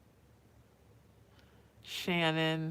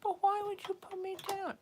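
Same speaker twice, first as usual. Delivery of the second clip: put-on high, small pleading baby voice; high, soft hurt baby plea; baby's voice; 12 semitones higher, 5 dB quieter than usual. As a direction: voice the little one's hurt question. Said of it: "with that face".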